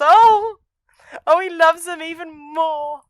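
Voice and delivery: high, loud, slow gushing swoon. Real, not acted. The podcaster swoons over the priests' love scene. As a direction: gush like a smitten fan. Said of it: "swooning over the scene".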